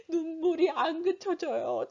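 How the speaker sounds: high, slow tearful whine; mock sobbing, unable to stop; imitated child crying. Recalling crying as a scolded child, imitated tearful voice. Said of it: "제가 막 이러면서 닦으면서 울면서".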